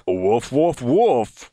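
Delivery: low, mock dog barks; dog barking. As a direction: bark like a dog down the phone. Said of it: "not speaking like a dog".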